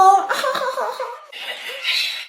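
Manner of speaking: high creepy cackle; creepy zombie laugh. Acted. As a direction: cackle like a monster.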